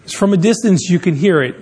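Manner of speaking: usual speaking voice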